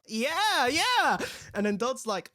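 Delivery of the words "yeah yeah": eager agreement. Retold or imitated. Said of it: "Freddy's just like".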